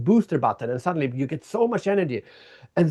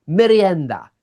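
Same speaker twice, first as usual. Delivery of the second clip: energized exclamation; 3 semitones higher, 6 dB louder, slower than usual. Retold or imitated. Glass artist failing again, an energized loud curse. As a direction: spit out the word like a curse. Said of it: "She went".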